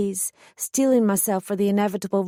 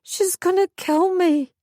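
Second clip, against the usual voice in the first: near tears; 9 semitones higher than usual. A narrator's reading. Shocked girl near tears.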